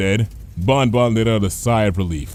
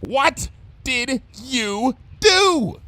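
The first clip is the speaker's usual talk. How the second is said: very slow, high, dread-filled question; alarmed demand; 14 semitones higher, much slower than usual. Acted. Bon Bon demanding what Lyra just did, dreading it. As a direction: draw it out in disbelief.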